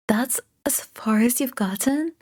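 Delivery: disbelieving whisper. Acted. Tutor checking homework, whispered disbelief.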